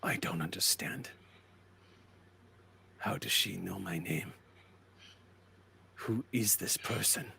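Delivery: very soft, low murmur; quietly baffled, with pauses between questions; hushed bewilderment. Acted. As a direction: puzzle it out almost under the breath. Nakamura baffled by the threat.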